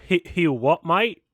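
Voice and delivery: a slight Australian twang on "mate", rising at the end; Australian accent. Pretend. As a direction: toss off "he what, mate?" in a light Australian accent. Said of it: "that sounded Australian for a second".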